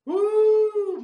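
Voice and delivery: high shouted holler of joy; exultant preacher whoop; ecstatic woo. Real, not acted. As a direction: let out a joyful church holler.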